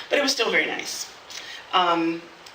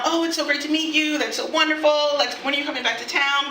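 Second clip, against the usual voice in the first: high-pitched imitation of effusive email chat; mock gushing; 7 semitones higher than usual. Trading emails after leaving town, mock gushing. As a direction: gush sweetly in a lifted voice.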